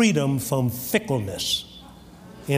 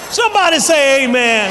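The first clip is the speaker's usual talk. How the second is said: high, loud preacher's cry; full-voiced pulpit holler, pitched high; rousing shout; 10 semitones higher, 8 dB louder than usual. Real, not acted. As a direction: shout to the congregation for a response. Preacher rouses congregation for amen.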